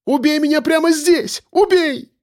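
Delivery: high, sustained desperate cry; screaming. A narrator's reading. Captive demands death right here, screaming. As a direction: cry it out at the top of your range. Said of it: "закричала Яна".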